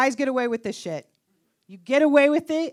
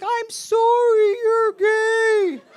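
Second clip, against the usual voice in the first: high, loud, slow mock wail; fainting mother; 8 semitones higher, 6 dB louder, much slower than usual. Canadian mother fainting on the way down, hammed-up wail. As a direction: wail it hammily while swooning to the floor. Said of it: "My Canadian mother would have fainted".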